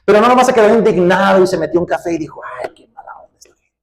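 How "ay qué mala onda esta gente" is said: soft whispered tsk; whispered mock gripe about these people; whispered mock complaint. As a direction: whisper it like idle gossip over coffee. Mocks idle complaint over coffee.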